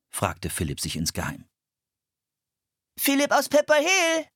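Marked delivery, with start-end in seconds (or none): 3.0-4.2 put-on much higher, louder little boy calling out; a little boy's bright, high call across the hall; bright child's voice. A narrator's reading. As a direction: call his name like a cheeky small boy. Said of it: "eine helle Stimme".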